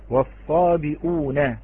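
والصابئون said slowly with the hamza, wasabiuna; slow clear reading. Shown as a demonstration. Teacher sounding out والصابئون for students. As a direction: draw out والصابئون keeping the hamza. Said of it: "الكلمة الأولى كلمة".

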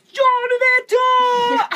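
high, loud, slow blurted cry; triumphant shout. Retold or imitated. Shouting the answer with three seconds left. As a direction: burst out with the answer.

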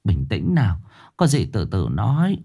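soft, low reassurance; calming a frightened girl by the bed; gentle soothing. Acted. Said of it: "nhẹ nhàng trấn an".

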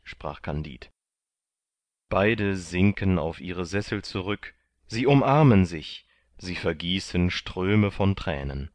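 ordinary delivery, nothing marked

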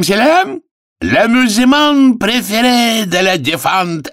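high, drawn-out ringing barker's call; showman's announcement. Acted. Arthur opens the cockroach races for the public, grandly high and drawn-out. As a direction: proclaim it slowly to the whole fairground.

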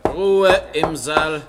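loud, slow call for order in court; Ruhe, loud and slow; stern call to order. Acted. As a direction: bang the gavel with your voice and drag each word. Judge calls court to order.

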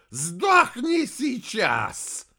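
high, loud, long-drawn furious roar; growling. A narrator's reading. Heir strangling the warrior, growling. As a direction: stretch the roar out viciously. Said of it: "прорычал носатый".

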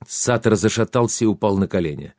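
usual speaking voice

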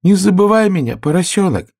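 high, loud plea; calling out. Acted. Flower calling after the departing piglet, high and loud. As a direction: call after him not to forget.

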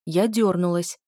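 ordinary delivery

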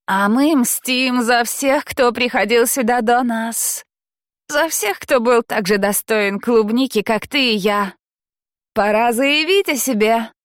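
rousing mischievous speech. A narrator's reading. Frances rouses her friend.

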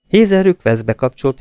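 ordinary delivery, nothing marked

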